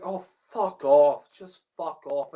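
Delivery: normal talking voice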